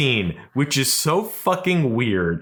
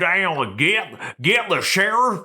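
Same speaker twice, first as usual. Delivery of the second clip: bad Southern accent; 7 semitones higher than usual. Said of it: "a British person trying to do a Southern accent".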